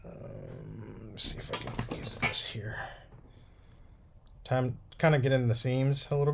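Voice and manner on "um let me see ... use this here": whispering. Real, not acted. Muttering to self while working.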